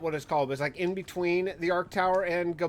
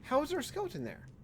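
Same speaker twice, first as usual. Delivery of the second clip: higher, fast, exasperated puzzlement; can't believe it's happening; baffled; 9 semitones higher, 7 dB quieter, faster than usual. Real, not acted. To viewers about the impossible skeleton, fast baffled question.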